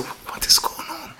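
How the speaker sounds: slow, hushed, unvoiced whisper. Rabbi whispers to the town head behind a locked door, hushed and slow.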